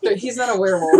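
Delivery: laughing. Real, not acted. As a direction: laugh through the denial. Laughing retort.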